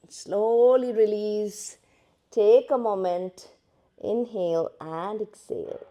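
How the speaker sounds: very slow, drawn-out calming cues; slow guided instruction. Shown as a demonstration. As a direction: ease the class out of the pose. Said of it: "yoga teacher guiding release". (बहुत धीमी, शांत करने वाली निर्देश वाली आवाज़)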